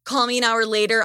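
usual speaking voice

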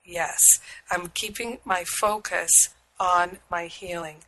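ordinary delivery

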